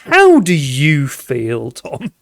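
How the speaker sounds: slow, drawn-out vampire-game drama; World of Darkness vampire. Pretend. Host to Tom in vampire-game mode, slow and dramatic. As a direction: ask it slowly, with gothic weight. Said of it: "Let's go into World of Darkness Vampire".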